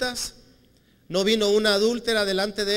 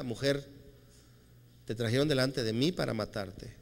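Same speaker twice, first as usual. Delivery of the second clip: softer and lower, gentle and grave; tender Jesus; 4 semitones lower, 5 dB quieter than usual. Retold or imitated. Jesus gently sending the accused woman away, soft and low. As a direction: speak to her softly, with quiet mercy.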